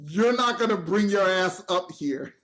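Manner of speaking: slow, loud, emphatic order; firm retold warning, drawn out; stern warning. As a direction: lay down the law word by word. Bowman's warning, acted out.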